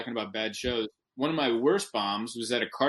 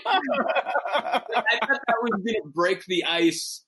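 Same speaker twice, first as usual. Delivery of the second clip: laughing; 9 semitones higher, 3 dB louder, much slower than usual. Real, not acted. Laughing at failed joke.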